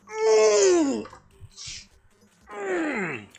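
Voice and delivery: high-pitched wordless whine; long, high hum of frustration; frustrated whine. Real, not acted. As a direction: whine through closed lips. Nearly said a banned word.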